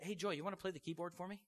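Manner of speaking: ordinary talk, nothing marked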